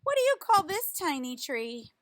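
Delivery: slow, lively picture-book reading voice; reading to children. Acted. Reading a tree picture book to children, lively storytime voice. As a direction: read it out like a storytime for little kids.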